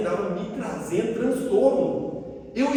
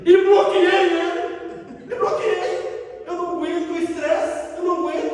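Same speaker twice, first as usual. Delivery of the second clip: comic outburst; 8 semitones higher, 4 dB louder than usual. Retold or imitated. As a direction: blurt it out high and stretched, playing up exasperation. Climax of blocking the friend. (desabafo exagerado, agudo e arrastado)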